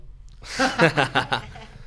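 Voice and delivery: loud burst of laughter; embarrassed laugh. Real, not acted. Laughs at forgetting.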